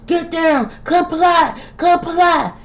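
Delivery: very high mock cop shouting comply; mocking officers screaming at a man on the ground; imitated police yelling. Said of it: "Be yelling".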